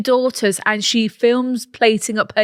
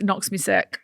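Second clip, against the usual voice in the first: Scouse catchphrase; 4 semitones lower, 8 dB quieter than usual. Shown as a demonstration. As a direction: demonstrate a Scouse accent. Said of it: "a Liverpudlian Scouse thing".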